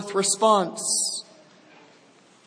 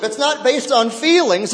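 ordinary talk, nothing marked

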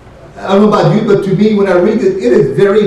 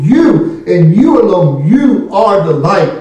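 YOU stretched out, swooping up and down; emphatic YOU. Shown as a demonstration. Preacher quoting Christ to his people, emphatic on YOU. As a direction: lean slowly on each YOU. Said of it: "it is emphatic".

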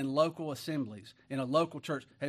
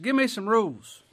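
Peter demanding; 6 semitones higher, 9 dB louder than usual. Acted.

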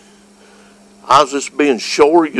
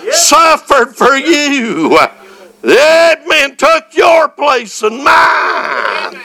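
preaching; 11 semitones higher, slower than usual. Acted. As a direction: preach it out with full fire. Preacher building to full fervor.

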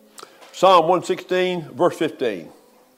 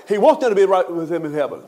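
normal talking voice

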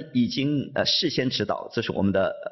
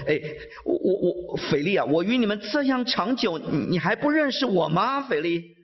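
higher, pained exasperation; frustrated plea to Philip, higher pitch; exasperated; 7 semitones higher than usual. Acted. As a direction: let the frustration crack through, higher. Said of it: "hear the frustration in his voice".

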